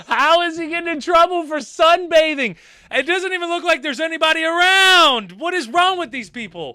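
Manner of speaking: high, loud real disbelief; incredulous. Real, not acted. Reactor watching a man stopped for sunbathing, incredulous.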